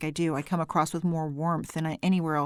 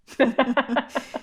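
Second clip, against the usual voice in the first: laughter; 7 semitones higher, 4 dB louder than usual. Real, not acted. Laughing at the teasing.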